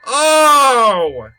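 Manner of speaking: startled yelp. Real, not acted. Jump scare in game.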